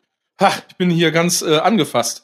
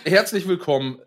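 normal talking voice

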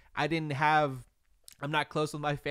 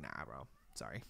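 much lower and softer flat refusal; mock brush-off; 21 dB quieter than usual. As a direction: mumble a cold no. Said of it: "I'd be like".

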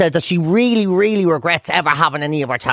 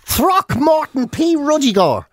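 slow, higher, proclaiming the name; grand announcement; 7 semitones higher, slower than usual. Shown as a demonstration. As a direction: announce it like a herald. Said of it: "announcing the name louder".

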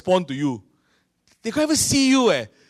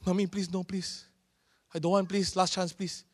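soft, much quieter pleading little voice; child begging; 10 dB quieter than usual. Retold or imitated. Child pleading with his mother for one last chance, quiet and pitiful. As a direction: beg mom not to cane you. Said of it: "that's what my children say".

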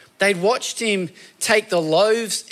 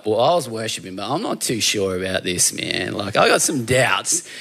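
a lightly voiced, lower laid-back bloke; a disciple confiding his doubts to Matthew, in a lowered casual voice; casual dude; 7 semitones lower than usual. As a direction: lower and slouch into a relaxed mate's voice.

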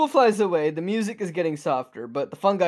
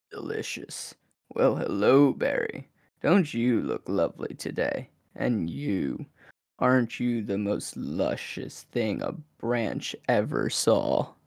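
as deep as you can go, slow and seductive; very low sexy voice; 7 semitones lower, 4 dB quieter, slower than usual. Shown as a demonstration. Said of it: "see how low I can go".

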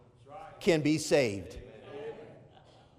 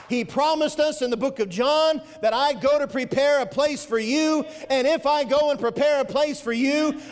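higher and driving, full of conviction; fervent preaching; 6 semitones higher than usual. Acted. Preacher assuring the congregation that Jesus keeps his promises, fervent. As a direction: press the promise home to the church.

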